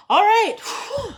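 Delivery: high, loud all right then a whew; excited exclamation. Real, not acted. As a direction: burst out excited at the card. Excited reaction to card.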